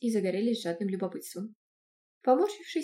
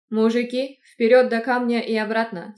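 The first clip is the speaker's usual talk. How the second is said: loud barked order to the men; commanding shout; 9 dB louder than usual. Acted. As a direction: call the men forward, commanding. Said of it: "скомандовал Дашек". (громкая команда)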